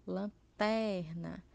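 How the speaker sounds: lanterna, slowly, with "ter" stretched out; stress on penultimate syllable. Shown as a demonstration. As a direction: lean hard on the middle syllable of lanterna. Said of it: "a sílaba tônica é a penúltima". (tônica alongada no "ter" de "lanterna")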